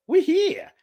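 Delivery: high-pitched, at a loss; re-enacted exasperation, voice lifted; exasperated helplessness. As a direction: throw it out helplessly. Acts being out of options.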